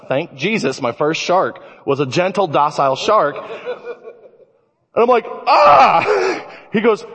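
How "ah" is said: high, loud comic yelp; sudden shriek of mock terror; startled scream. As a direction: scream as if a shark just surfaced. Startled as shark head appears.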